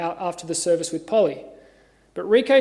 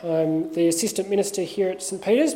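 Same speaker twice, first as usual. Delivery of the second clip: usual speaking voice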